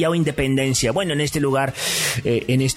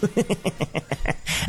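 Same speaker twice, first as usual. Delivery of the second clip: breathy laugh; 7 semitones higher, 6 dB quieter than usual. Real, not acted. Laugh after joke.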